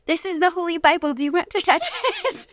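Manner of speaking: put-on high, sweet little-girl voice; playful toddler impression; imitating a baby daughter. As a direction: voice a tiny child proudly showing the Bible. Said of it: "she would go".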